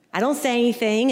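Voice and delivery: ordinary delivery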